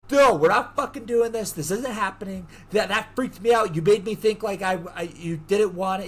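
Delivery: high, heated acted refusal; upset protest. He refuses her after the freak-out, upset protest.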